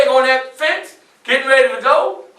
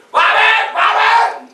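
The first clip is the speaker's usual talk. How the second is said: calling out; 9 semitones higher, slower than usual. Retold or imitated. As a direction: shout for Robert to come help. Master calling a slave in.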